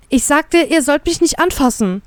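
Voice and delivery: high-pitched, indignant nagging; scolding. A narrator's reading. Shoved back on the chair, scolding. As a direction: rail at them indignantly. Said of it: "keife ich".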